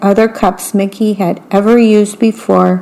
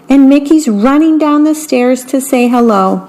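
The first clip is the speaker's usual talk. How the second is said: ordinary delivery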